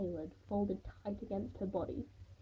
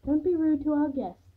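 plain reading voice

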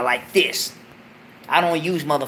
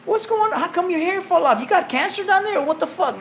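put-on high, startled girlfriend squealing questions; 11 semitones higher than usual. Girlfriend shocked by his shaved crotch, high squealing voice. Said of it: "She go".

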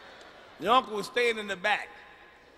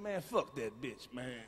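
lower, softer, slow grumble; low mumbled cousin; 9 semitones lower, 12 dB quieter, slower than usual. Retold or imitated. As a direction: mutter it from the back seat. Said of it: "He said".

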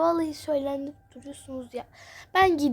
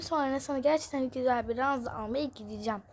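ordinary talk

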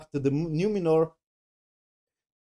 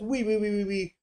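high, repeated fake whimpers; mock sobbing; 6 semitones higher than usual. Reviewer mocking her going to take punishment, mock sobbing. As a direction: go "wee wee" like a cartoon crier.

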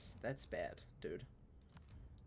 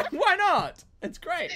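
high, loud, laughing protest; 15 dB louder, much slower than usual. Real, not acted. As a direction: squeal it through real laughter.

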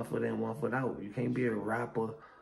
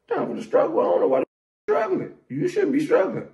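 very high, loud squawk of disbelief; incredulous outburst; 12 dB louder than usual. Pretend. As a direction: shriek it back in mock outrage. Incredulous echo of friend's complaint.

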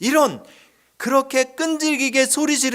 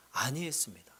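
whispered; 7 semitones lower, 17 dB quieter than usual. Shown as a demonstration. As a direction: drop to a whisper. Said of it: "whispered for effect". (속삭이듯)

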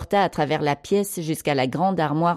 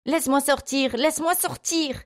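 put-on high panicked child's plea, rising higher; frantic high-pitched cry without much volume; terrified, hysterical; 10 semitones higher than usual. A narrator's reading. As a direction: beg hysterically to be let out. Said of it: "Marc se mit à hurler, terrifié et hystérique".

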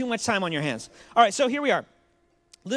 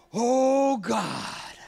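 high, loud, slow hammed-up preacher prayer; mock grandiose prayer; 6 semitones higher, 4 dB louder, much slower than usual. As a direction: overblown holy-roller prayer. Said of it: "He doesn't even add syllables".

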